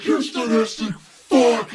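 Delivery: slow, strained shout with a crying edge; pissed-off outburst. Retold or imitated. Reviewer quoting Deadpool's tortured outburst, as a strained shout. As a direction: yell it out, furious and anguished. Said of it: "he's just pissed".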